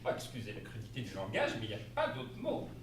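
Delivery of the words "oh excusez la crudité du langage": ordinary delivery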